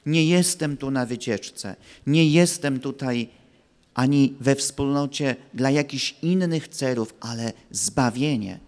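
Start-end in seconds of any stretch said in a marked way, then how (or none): none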